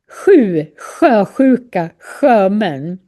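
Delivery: "Sju, sjösjuka, sjömän" as separate words, each sj sounded fully; sj sound drill. Shown as a demonstration. Teacher models the difficult sj sound, careful pronunciation drill. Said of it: "Sju kanske är jättesvårt att säga".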